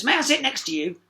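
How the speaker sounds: frog voice. A narrator's reading. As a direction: ask sweetly as the frog. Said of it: "it said approvingly".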